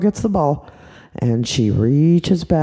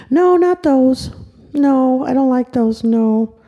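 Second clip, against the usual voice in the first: put-on picky little girl; 7 semitones higher, slower than usual. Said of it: "She looked around".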